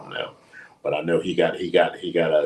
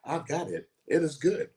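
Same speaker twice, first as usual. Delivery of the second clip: imitating Siri, stiff and mechanical, pitched higher; robotic Siri voice; 8 semitones higher than usual. Recalling Hook's reply, doing a robotic Siri voice. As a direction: say it like a phone assistant answering. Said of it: "it sounds like Siri".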